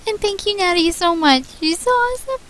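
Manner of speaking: high, loud gushing thanks. Real, not acted. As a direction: gush with delight.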